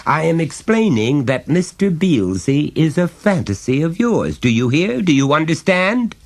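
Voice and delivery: high, emphatic, pompous father; lecturing father. A narrator's reading.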